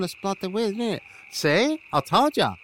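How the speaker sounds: ordinary delivery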